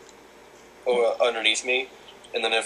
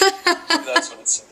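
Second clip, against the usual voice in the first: high teasing squeal; 5 dB louder than usual. Acted. Teasing friend.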